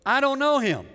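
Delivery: high, loud, emphatic denial; dismissive Pharaoh. Acted. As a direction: throw it out loudly as a scornful king. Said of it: "he goes".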